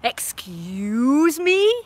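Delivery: very slow, drawn-out affronted; indignant excuse me. Retold or imitated. Answering the busybodies, slow and indignant. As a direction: stretch it out in disbelief. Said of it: "And I'm like".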